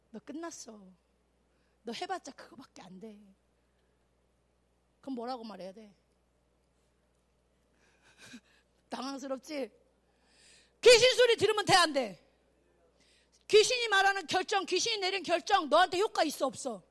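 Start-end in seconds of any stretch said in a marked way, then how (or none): 0.1-3.2 low, soft discouraging voice; quiet put-down. Acted. World discouraging a believer, acted. 10.8-16.8 loud, high challenge; forceful preaching. Real, not acted.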